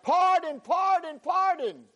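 the governor screaming pardon at the glass; desperate shout. Acted. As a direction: yell through the glass to stop the execution. Governor banging on soundproof glass.

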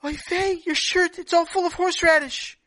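high, loud Yiddish mother shriek; yiddish accent. Acted. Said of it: "his mother said".

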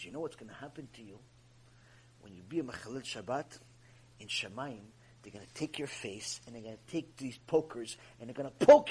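low, hushed menace building in volume; ominous warning. Retold or imitated. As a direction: start very quiet and low, foreboding. Rabbi ominously describes punishment.

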